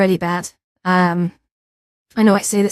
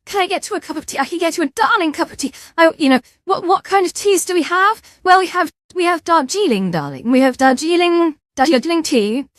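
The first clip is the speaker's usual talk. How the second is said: higher, sing-song posh hostess voice; posh tea-shop server; 4 semitones higher than usual. Acted. Streamer as a butler serving tea to chat, posh voice. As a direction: play a prim waitress greeting a guest. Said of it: "I feel like a Karen in a restaurant".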